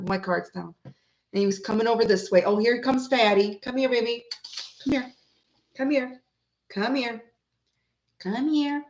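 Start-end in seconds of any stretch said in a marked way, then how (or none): 3.6-8.8 soft, coaxing pet call; coaxing the cat. Real, not acted. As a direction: gently call the kitty over. Calling her cat over.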